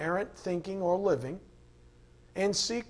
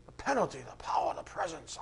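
whispered list, no voice at all; 7 dB quieter, faster than usual. Acted. Preacher whispering the three Ps to the congregation. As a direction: breathe the three Ps out like a secret.

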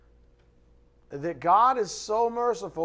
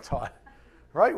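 ordinary talk, nothing marked

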